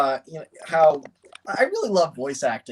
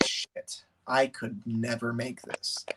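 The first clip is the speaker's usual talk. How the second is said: hushed mock dismay; 4 semitones lower, 8 dB quieter, slower than usual. Acted reaction to long credits.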